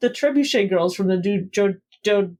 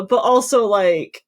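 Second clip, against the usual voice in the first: ordinary talk, nothing marked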